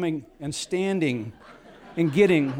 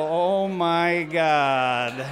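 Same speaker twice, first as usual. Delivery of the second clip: very slow, louder laughing "Oh, my God"; laughing astonishment; stunned laughing; 5 dB louder, much slower than usual. Retold or imitated. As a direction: drag it out in disbelief. Amazed owl asked for shotgun.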